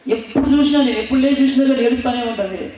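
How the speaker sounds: pitched high and pushed hard with exasperation; fervent outcry. Real, not acted. Preacher recalling his mother always weeping, high and fervent. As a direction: cry it out high like a preacher in full flight.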